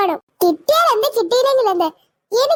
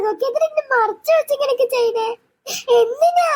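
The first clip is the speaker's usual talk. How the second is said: higher, crying reproach rising to a sob; crying accusation; 3 semitones higher than usual. Acted. Sister sobbing at her family for hiding the dog, crying. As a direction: break down crying as you accuse.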